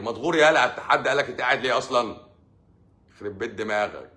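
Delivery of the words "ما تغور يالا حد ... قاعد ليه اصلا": louder, higher mock snap at the greeter; half-joking shove-off, loud and high; mock angry snap. Mock rude reply to goodnight.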